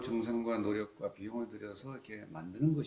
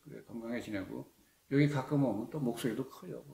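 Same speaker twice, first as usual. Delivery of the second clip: ordinary talk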